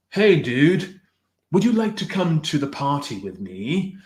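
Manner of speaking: hammed-up, badly faked British accent; awful British accent. Streamer mocking an actor's Dracula role, awful British accent. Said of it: "doing the worst British accent ever".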